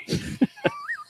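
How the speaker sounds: sudden high laugh; high burst of laughter. Real, not acted. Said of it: "And he starts laughing".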